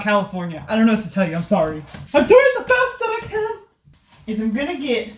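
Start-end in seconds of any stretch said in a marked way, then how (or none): 2.1-3.6 whiny. Real, not acted. Defensive whine about kitchen.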